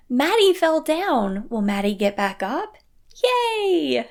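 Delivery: bright, slow "Maddie fell down" rising to a high cheerful "Yay!"; positive surprise. Shown as a demonstration. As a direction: cheer the child on with upbeat surprise. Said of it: "with a more positive sense of surprise".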